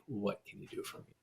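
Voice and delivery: self-serving ask, muttered. Shown as a demonstration. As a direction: throw away the taker's line quietly. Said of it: "turn that narrative around".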